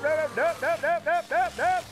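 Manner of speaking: frantic high yell of run; panicked shouting while fleeing; yelling while running away. Real, not acted. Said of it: "yelling while running away".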